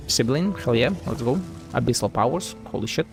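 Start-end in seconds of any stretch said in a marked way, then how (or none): none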